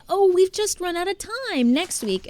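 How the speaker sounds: high, bright fake apology; mock innocent excuse. Coach fakes a sorry excuse to the kids, high and bright. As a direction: sound sweetly sorry while dodging. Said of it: "Or I'll say".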